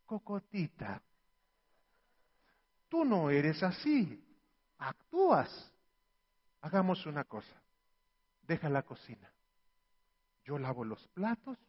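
calm her down gently; tender reassurance. Acted. Husband soothes his stressed wife, soft and tender.

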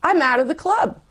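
sudden high-pitched panic on club; mock-alarmed wail, rising. Retelling her fear of exclusion, high alarmed cry.

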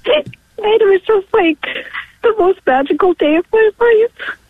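high voice welling with tears; real, overcome with gratitude; tearful, pausing to hold it together; emotional. Real, not acted. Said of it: "wasn't expecting to get so emotional".

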